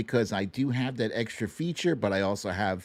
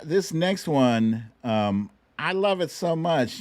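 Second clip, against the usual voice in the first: ordinary talk, nothing marked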